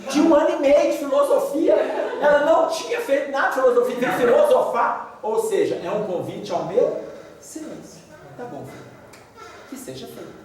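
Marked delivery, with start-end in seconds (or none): 0.1-5.2 louder words through real laughter; real laughter, higher and louder; laughing. Real, not acted. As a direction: laugh while telling it. Laughing at daughter's philosophy.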